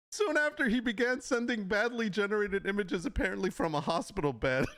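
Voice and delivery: high-pitched, fighting back real laughter; reading through laughter. Real, not acted. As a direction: try to read the news while cracking up. Cracks up reading the article aloud.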